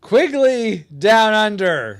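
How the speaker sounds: loud, high, slowly spaced words; exasperated correction. Real, not acted. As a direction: spell it out emphatically, exasperated. Exasperated correction of the wrong film.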